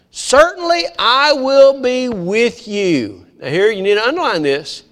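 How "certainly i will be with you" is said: slow solemn God voice. Acted. Preacher voices God's promise.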